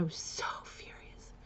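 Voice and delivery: hushed, seething whisper; whispered fury. Real, not acted. Telling viewers about glaring at the judge, whispered. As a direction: whisper the anger.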